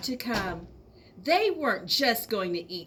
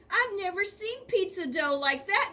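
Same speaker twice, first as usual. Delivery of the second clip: put-on high, louder amazed child; amazed wow; 9 semitones higher, 4 dB louder than usual. A narrator's reading. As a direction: gasp in wonder at the shapes.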